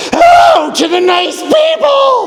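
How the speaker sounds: very high, annoyed mock yell; annoyed shriek. Girlfriend inside sleeping bag echoing the prompt, annoyed high-pitched yell. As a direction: screech the greeting back in irritation. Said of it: "you hear this very annoyed voice say".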